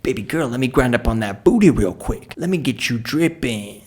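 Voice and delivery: imitated club pickup talk, slowing at the end; Josh. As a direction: imitate a buddy's sleazy come-on in a club. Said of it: "He says things like".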